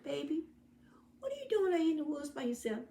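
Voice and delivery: high, fast, soft concerned man; a caring hunter questioning the lost girl; gentle hunter. Acted. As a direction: ask her kindly like a worried rescuer.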